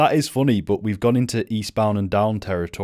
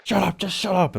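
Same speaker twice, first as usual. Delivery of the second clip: high-pitched imitation of a film outburst; hammed-up cross snap, much higher; mock exasperation; 11 semitones higher, 3 dB louder than usual. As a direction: parrot the angry line in a raised pitch. Mimics the film line just played.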